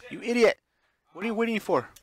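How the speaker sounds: high, loud frantic yell; desperate scream. Acted. Ashley screaming at the frozen hero to flee. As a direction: scream at him to run. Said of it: "sounding even more desperate".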